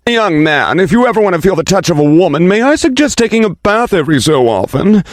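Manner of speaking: high, fast, repulsed woman's voice; repulsed woman yelling. Acted. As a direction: call out in disgust from behind. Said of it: "a woman's repulsed voice in the back yell at him".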